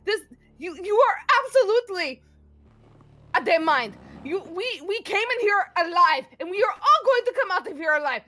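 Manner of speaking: high, loud desperate yelling at a friend; angry pleading. Acted. Grendel yelling at Emrys not to die, desperate and angry. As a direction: scream at him not to give up. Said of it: "being like".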